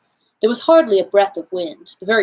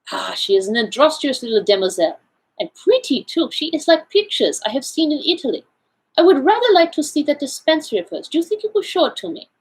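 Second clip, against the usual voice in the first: lightly voiced Belgian detective, higher pitch; a fussy little foreigner, pitched up; Poirot; 7 semitones higher than usual.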